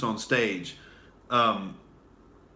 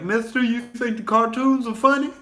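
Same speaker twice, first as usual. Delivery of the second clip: put-on lightly raised child's voice, innocent question; kid voice; 11 semitones higher, 4 dB louder than usual. Her kid asking the date guy about cartoons, lightly childlike. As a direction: ask like a curious little kid. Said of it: "kids are looking at us like".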